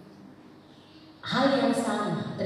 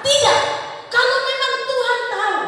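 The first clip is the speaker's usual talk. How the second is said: high, shouted protest; acted indignant outburst; angry retort; 8 dB louder than usual. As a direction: throw the objection back at the prophet. Acting Israel's angry retort.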